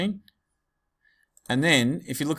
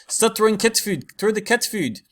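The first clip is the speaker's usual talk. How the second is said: higher, louder South African accent, a rough attempt; 9 semitones higher, 4 dB louder than usual. Teacher imitating Vickers in a South African accent. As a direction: shout the order in a tried South African accent. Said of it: "trying to do the South African accent".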